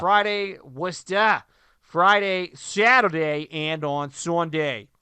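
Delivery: hammed-up, wandering Worcester accent; terrible regional accent. Host listing game days in Worcester, terrible put-on local accent. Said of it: "That was a terrible accent".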